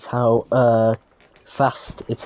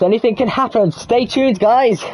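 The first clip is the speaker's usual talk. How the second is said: high, loud hype sign-off; excited; 11 semitones higher, 4 dB louder than usual. Real, not acted. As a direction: pump up the viewers. Upbeat sign-off to viewers.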